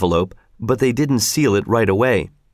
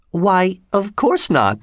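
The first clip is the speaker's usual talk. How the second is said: higher woman's voice, mildly protesting; Missus Giry; 7 semitones higher, slower than usual. A narrator's reading. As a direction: deny it lightly, pitched up. Giry denies knowing envelope's contents.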